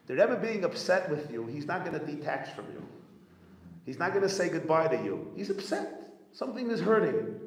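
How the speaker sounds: high, loud and urgent, pressing the point home; emphatic reassurance. Retold or imitated. Rabbi Yoel urging the upset chassid, high loud reassurance. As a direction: reassure him forcefully, almost pleading.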